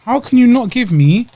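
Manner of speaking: high outraged complaint; exasperated. Real, not acted. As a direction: pitch it up in disbelief. Complaining about no spoons.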